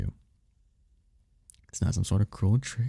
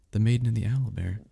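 ordinary talk

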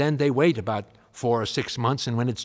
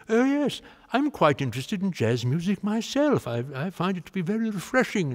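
a hammed-up posh Englishman's delight; English gentleman voice; 8 semitones higher than usual. Elgar voiced in retold chat.

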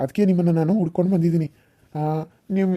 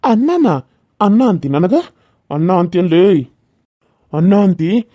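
furious shout; 5 dB louder, slower than usual. Acted. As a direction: bellow it back like an insult. Villain enraged at being called brother. (ಕೋಪದ ಕೂಗು)

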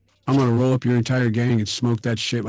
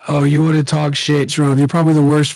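angry yelling; 2 semitones higher, 8 dB louder than usual. Acted. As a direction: yell the insults right at him. Mock presidential trash-talk argument.

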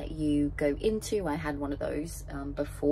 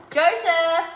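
calling out; 12 semitones higher, 10 dB louder than usual. Real, not acted. Calling partner to come eat.

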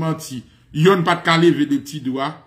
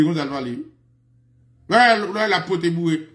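ordinary talk

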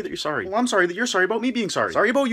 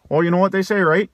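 usual speaking voice

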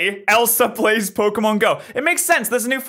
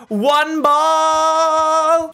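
high, stretched-out shout; triumphant yell; 10 semitones higher, 3 dB louder than usual. Real, not acted. Player catching it in one ball, triumphant held yell. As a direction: hold the last word long and high.

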